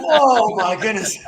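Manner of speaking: normal talking voice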